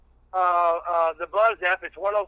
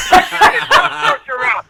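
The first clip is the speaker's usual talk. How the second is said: higher, louder laughing yell; laughing shout; 10 semitones higher, 8 dB louder than usual. Real, not acted. Guest laughs about his old Honda, shouting with laughter. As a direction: laugh it out at the top of your voice.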